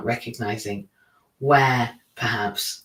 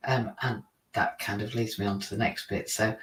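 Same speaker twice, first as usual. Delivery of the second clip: usual speaking voice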